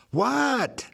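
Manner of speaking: high, startled single-word question; puzzled what. Acted. Diane caught off guard by her choking coworker, startled and high.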